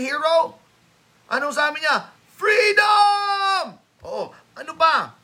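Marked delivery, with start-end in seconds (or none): 2.3-3.8 high, loud battle cry; William Wallace battle cry. Retold or imitated. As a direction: roar "Freedom!" like a rebel leader charging. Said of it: "To William Wallace". (malakas na sigaw ni William Wallace)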